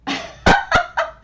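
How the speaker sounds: squeaky little laugh; high puppet giggle. Acted.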